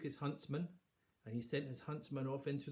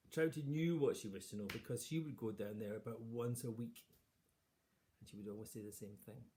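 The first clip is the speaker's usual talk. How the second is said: ordinary delivery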